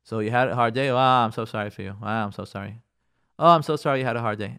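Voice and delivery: low, perfunctory mock sympathy; grumpy brush-off. Spouse half-listening to wife's hard day, grumpy token sympathy. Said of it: "not just to grump and say".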